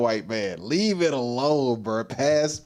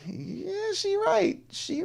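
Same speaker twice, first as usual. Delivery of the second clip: high squeal; 4 dB quieter, slower than usual. Real, not acted. Reactor squeals agreement at the woman, very high. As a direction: squeal "she right" way up high.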